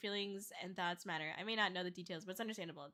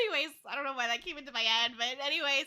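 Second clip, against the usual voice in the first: laughing; 5 semitones higher, 7 dB louder than usual. Real, not acted.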